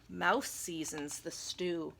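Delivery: plain reading voice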